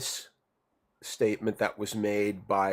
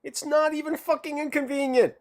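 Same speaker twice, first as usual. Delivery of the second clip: much higher, emphatic; indignant outburst; 14 semitones higher than usual. Real, not acted. Friend bristling at the excuse, much higher indignant. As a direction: bristle with disbelief.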